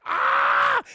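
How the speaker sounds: low, loud startled shout; hammed-up shocked yell; mock group scream. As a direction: bark out a shocked cry. Group sees her die.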